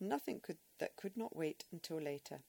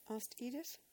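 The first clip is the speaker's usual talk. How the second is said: ordinary delivery, nothing marked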